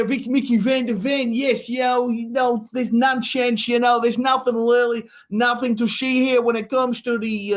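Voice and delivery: hammed-up Dutch accent. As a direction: put on a Dutch footballer's accent. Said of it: "we're gonna go back to the Dutch".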